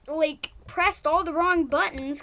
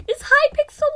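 mock disbelief; 11 semitones higher, 11 dB louder than usual. Real, not acted. Joking server listens to her.

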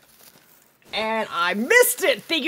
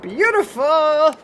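loud drawn-out Beautiful; excited; 5 semitones higher, 7 dB louder, slower than usual. Real, not acted. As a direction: savour the great shot. Clean kill shot.

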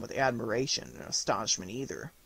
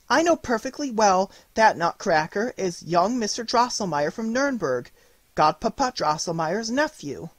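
put-on high, loud girlish protest; a little girl begging to be believed; pleading girl; 8 semitones higher, 4 dB louder than usual. A narrator's reading. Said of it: "answered Marie".

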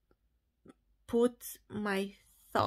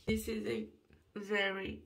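ordinary talk

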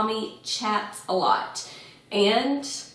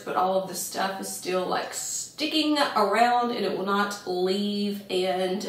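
normal talking voice

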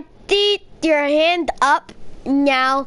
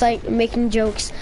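normal talking voice

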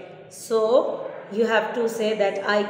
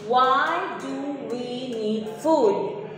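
slow reading; slower than usual. Shown as a demonstration. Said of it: "teacher reads the question slowly".

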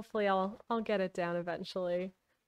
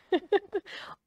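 laugh; 10 semitones higher than usual. Real, not acted. Laughs after asking for help.

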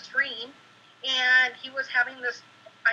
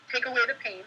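usual speaking voice

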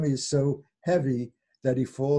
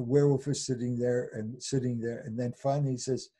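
normal talking voice

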